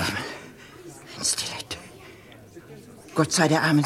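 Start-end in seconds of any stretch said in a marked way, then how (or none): 0.0-1.8 very slow, breathless whisper; a woman realising who the murderer might be; horrified whisper. Acted. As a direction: let the word die in fear. Servant recognises the murder weapon.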